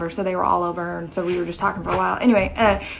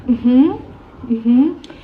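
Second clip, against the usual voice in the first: knowing mm-hmm; 5 semitones higher, 7 dB louder than usual. Retold or imitated. Loud knowing agreement.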